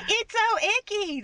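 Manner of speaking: high-pitched squeal of mock disgust; exaggerated, squeaky grossed-out voice. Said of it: "everybody else is like".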